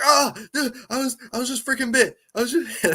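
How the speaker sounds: high-pitched, quick mock panic; freaking out. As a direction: imitate someone panicking after a bite. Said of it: "so Zach was freaking out".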